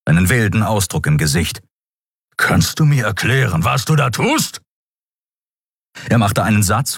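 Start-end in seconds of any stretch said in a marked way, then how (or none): 2.4-4.6 angry demand. A narrator's reading. Keru bursts in angry.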